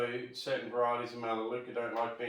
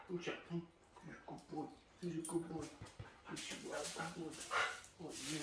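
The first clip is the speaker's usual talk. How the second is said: much softer, higher doggy praise; praising the dog; 3 semitones higher, 13 dB quieter, faster than usual. Real, not acted. Owner softly praising his dog as a good boy. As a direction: murmur praise close to the dog.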